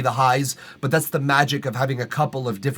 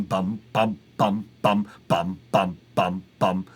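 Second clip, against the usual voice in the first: cello strum; 6 semitones lower, slower than usual. Shown as a demonstration. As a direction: lower sung cello bums. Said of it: "lower sort of cello strum".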